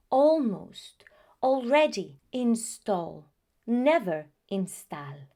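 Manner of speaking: almost, already, install with the aw of all; aw sound. Shown as a demonstration. Teacher modelling the British aw vowel, firm and clear. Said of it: "the pronunciation is not always".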